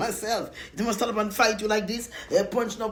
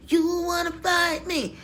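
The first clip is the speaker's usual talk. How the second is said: mock shouted challenge; 9 semitones higher, slower than usual. Daring a mad viewer to fight, mock shout. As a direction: yell the dare up high, playful. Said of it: "mock challenge, shouted take".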